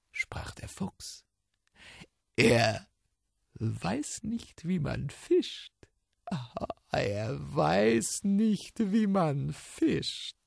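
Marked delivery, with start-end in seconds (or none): 2.4-10.4 mocking fox.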